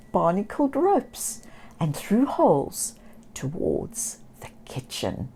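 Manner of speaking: usual speaking voice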